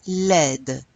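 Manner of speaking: Laide with the D clearly sounded at the end; final D sounded. Shown as a demonstration. Teacher showing the class the sounded D in Laide. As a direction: land hard on the D of Laide. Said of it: "when we add an E, we do pronounce it".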